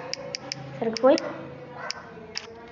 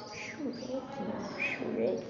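whispering; 16 dB quieter, much slower than usual. Real, not acted. Hushed aside about Shrek.

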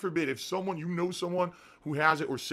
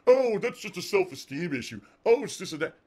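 mocking chat; 4 semitones higher, 5 dB louder than usual. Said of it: "idiots in the stream chat are saying".

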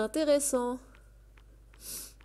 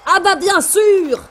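excited shout; 8 semitones higher, 16 dB louder, slower than usual. Real, not acted. Wins blackjack hand by luck.

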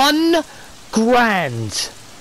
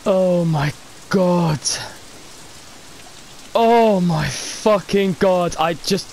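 ordinary delivery

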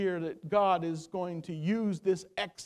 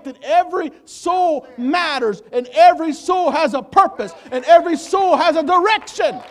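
rising, loud preacher's cry with a laugh; preaching at full force; 5 semitones higher, 8 dB louder than usual. Acted. Preacher to his congregation, rising to a loud joyful cry. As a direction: build it up and shout it out to the church.

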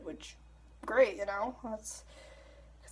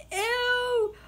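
ordinary talk

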